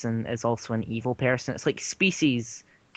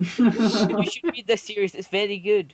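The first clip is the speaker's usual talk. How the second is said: mock ex; 6 semitones higher, 4 dB louder than usual. Mimicking the ex girlfriend.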